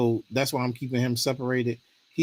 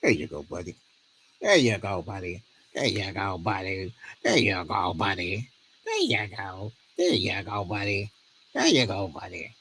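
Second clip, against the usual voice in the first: lower, softer coaxing murmur; soothing the dog; 6 semitones lower, 5 dB quieter than usual. Real, not acted. Owner calming his dog Ace in front of the camera, soft and low. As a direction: settle the dog gently, over and over.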